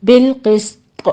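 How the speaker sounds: بالقسط slow, heavy qaf, whistled sin, bounced ta; بالقسط drawn out, qaf full and the ending jolted; slow tajweed recitation. Shown as a demonstration. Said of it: "طا پر قلقلہ کریں گے".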